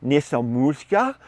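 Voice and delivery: slow, careful new word; slow teaching voice. Retold or imitated. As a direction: teach it slowly to a class. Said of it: "she's saying things like".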